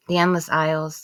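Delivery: ordinary delivery, nothing marked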